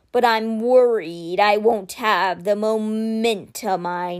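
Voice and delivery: very slow, weighty creature voice; measured and solemn, a stone guardian speaking; slow guardian statue voice. Acted.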